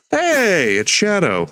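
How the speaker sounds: high excited burst; delighted discovery. Real, not acted. Spots a Shadow build excitedly.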